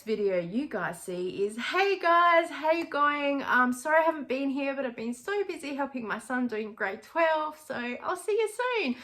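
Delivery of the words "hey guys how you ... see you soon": much higher, bright upbeat YouTube greeting; fake-cheerful vlogger. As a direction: paste on a happy face for the camera. Mock cheerful YouTube intro masking stress.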